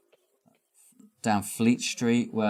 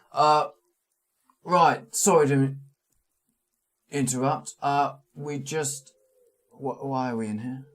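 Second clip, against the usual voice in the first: guide voice; 5 semitones higher, 5 dB louder than usual. Acted.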